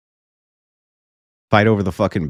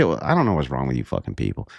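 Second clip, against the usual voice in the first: ordinary talk, nothing marked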